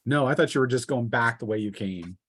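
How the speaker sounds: ordinary talk, nothing marked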